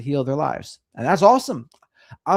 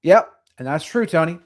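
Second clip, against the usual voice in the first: usual speaking voice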